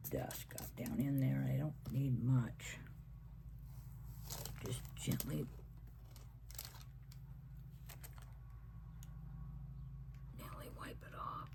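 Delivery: hushed whisper while working carefully. Real, not acted. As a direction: whisper gently as you wipe it off. Whispering while wiping the painting.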